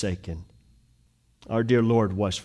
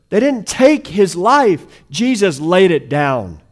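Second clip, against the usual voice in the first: higher and louder, driving sermon climax; full-force preaching; 10 semitones higher, 8 dB louder than usual. Acted. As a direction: preach it hard to the congregation.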